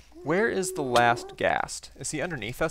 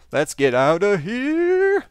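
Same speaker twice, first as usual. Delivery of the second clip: louder, slow, stretched urgent cry; drawn-out urgent call; 6 semitones higher, 8 dB louder, slower than usual. Real, not acted. Player fleeing the burning fortress, stretched loud urgent call. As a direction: drag it out like a siren while fleeing.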